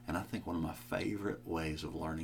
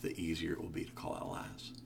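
usual speaking voice

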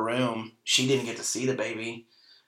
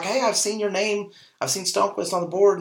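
eager nurse greeting; 9 semitones higher than usual. Retold or imitated. Nurse greets him, surprised to see him.

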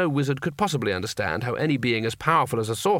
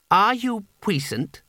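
put-on high, slow child's voice; young boy; 7 semitones higher, slower than usual. A narrator's reading. Boy questions an old wizard, deliberate child's voice. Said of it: "said the boy".

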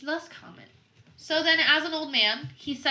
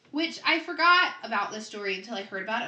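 normal talking voice

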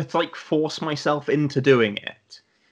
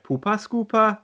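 higher mock Geordie; Geordie accent; 9 semitones higher than usual. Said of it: "he does a Geordie one".

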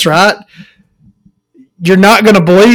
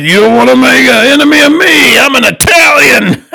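mock mobster; 8 semitones higher than usual. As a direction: ham up a Sopranos gangster threat. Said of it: "Straight out of The Sopranos".

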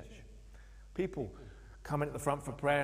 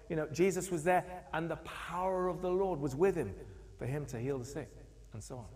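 usual speaking voice

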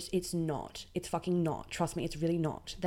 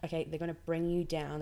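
normal talking voice

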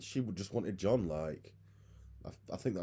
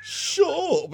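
retold shut up; 11 dB louder than usual. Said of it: "I was like".

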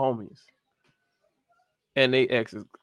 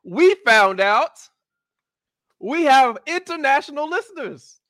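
excited news; 11 semitones higher, 7 dB louder than usual. Real, not acted. Host announcing international listeners, high and excited. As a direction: share the big news proudly.

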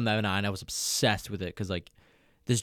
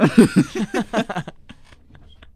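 high squealing laugh; giggly tickle attack; 12 semitones higher, 10 dB louder than usual. Real, not acted.

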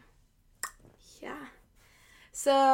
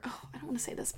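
whispering; 16 dB quieter, much faster than usual. Real, not acted. Backing off a painful word.

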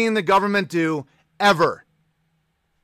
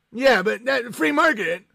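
higher, put-on whiny voice; mock whine; 5 semitones higher than usual. Streamer mocking a stingy listener's free market line. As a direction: parrot the boomer's excuse.

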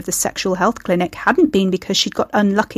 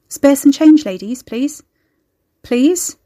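higher, urgent appeal rising at the end; begging; 6 semitones higher, 6 dB louder, slower than usual. A narrator's reading. Young man begs passing women for money, urgent plea.

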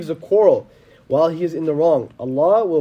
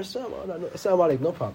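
much softer, easy dismissal; soft brush-off; 8 dB quieter than usual. Retold or imitated. Answering an ignorant man with a soft, easy salam. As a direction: let it go quietly.

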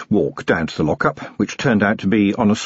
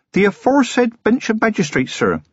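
put-on high-pitched, deferential young policeman; respectful, high and earnest; dutiful young constable; 13 semitones higher than usual. A narrator's reading. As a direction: answer officially in a youth's high voice. Young constable answers superior.

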